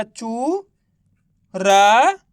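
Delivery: drawn-out र, pause, then न; syllable by syllable. Shown as a demonstration. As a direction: sound out र and न separately. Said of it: "तो मिलके बन गया". (र, न को अलग-अलग खींचकर)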